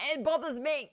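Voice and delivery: loud, high-pitched complaint; exasperated outburst. Real, not acted. Host vents about the narrator's pronunciation, exasperated outburst. Venting over the mispronounced name.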